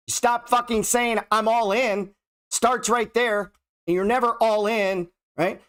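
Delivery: heated, high-pitched scolding; getting mad. Real, not acted. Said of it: "Now I'm getting mad".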